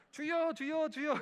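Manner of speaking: high, loud cries of 주여; shouting 주여. Retold or imitated. A boy leads his sister in crying out 주여, loud and high. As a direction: cry out 주여 three times at full force. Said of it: "외치는 거예요".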